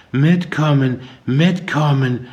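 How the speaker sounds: high, drawn-out, absent voice; dazed repetition. A narrator's reading. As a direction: repeat it like she's not really there. Mandy keeps repeating, dazed.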